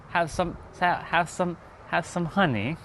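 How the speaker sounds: playful attempt at a British accent; mock British accent. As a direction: try on a light British accent for "have some honey". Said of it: "if she heard me speaking that British".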